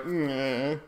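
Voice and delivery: lightly voiced mopey Leroy, slowing at the end; a hint of a sulky Leroy; imitating Leroy. Voicing Leroy out of pool.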